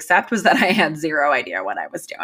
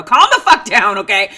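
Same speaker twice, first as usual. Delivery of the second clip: put-on much louder imagined yell at the child; pretend yell; 8 dB louder than usual. Mom imagines yelling at her panicking daughter, loud pretend yell. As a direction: bark it like you're at the end of your rope. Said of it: "shoulders and yell".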